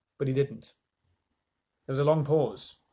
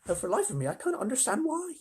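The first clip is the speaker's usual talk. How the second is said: much higher ladybird; 10 semitones higher than usual. Acted. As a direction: tease lightly, high. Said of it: "said the ladybird".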